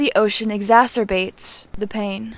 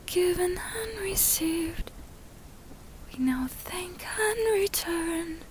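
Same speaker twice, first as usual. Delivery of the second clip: soft, slow, high whispered chant; barely audible high whisper; 8 semitones higher, 12 dB quieter, slower than usual. A narrator's reading. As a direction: whisper it, almost silent. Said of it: "Sakura whispers".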